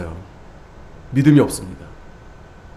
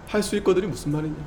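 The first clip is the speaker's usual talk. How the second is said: quiet repetition; 10 dB quieter, faster than usual. Shown as a demonstration.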